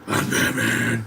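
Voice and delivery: mock gruff superhero rasp; Batman. Podcaster ridiculing Bale's stupid voice, exaggerated gruff Batman impression. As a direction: drag it out in a silly hero growl. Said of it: "It's a stupid voice".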